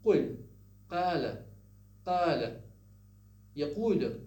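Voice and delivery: قل, قال, يقول loud, each with a uvular stop; Fusha pronunciation. Shown as a demonstration. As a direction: hit each Q of قال firmly, classical Arabic style. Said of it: "the Fusha way of saying it".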